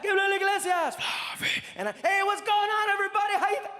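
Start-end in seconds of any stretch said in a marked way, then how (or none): 0.0-1.0 high booming announcer call; mock arena announcer. Announcer intro retold. 1.9-3.7 high, loud cheery showman greeting; bright stage hello that breaks off; mock chipper opener cut short. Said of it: "I froze".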